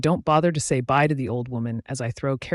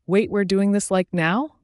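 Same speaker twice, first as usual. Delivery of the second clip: raised, puzzled questioning; confused; 6 semitones higher, 4 dB louder than usual. A narrator's reading. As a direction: ask it in loud confusion. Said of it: "Luke asks, confused".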